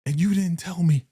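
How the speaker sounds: hammed-up angry friend; imagined Tiff berating Keisha, outraged; mock outrage. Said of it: "be like".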